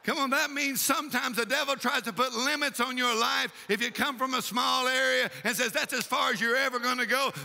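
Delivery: building to a high, urgent exhortation; fired-up preaching. Real, not acted. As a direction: drive the promise home, climbing in pitch. Preaches to the congregation.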